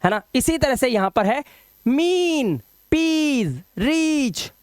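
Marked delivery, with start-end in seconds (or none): 1.9-4.5 "मीन, पीस, रीच" high with held ee; drawn-out model "मीन, पीस, रीच"; long ee sound. Shown as a demonstration. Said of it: "ये sound निकाल के".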